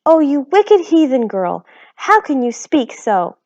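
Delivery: high, loud horrified scolding; horrified mother scolding; shocked. A narrator's reading. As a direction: recoil in horror. Homily shocked.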